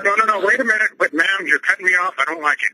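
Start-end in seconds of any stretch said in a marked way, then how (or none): 0.0-0.9 raised-pitch urgent objection; indignant, higher-pitched interruption; insistent protest. Real, not acted. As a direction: jump in before they cut you off. Caller objects to being cut off.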